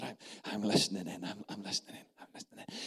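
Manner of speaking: dropping to a whisper as he eavesdrops; fading from low voice into a whisper; conspiratorial whisper building down; hushed. Retold or imitated. Preacher leaning in to eavesdrop.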